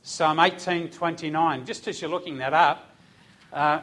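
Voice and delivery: ordinary delivery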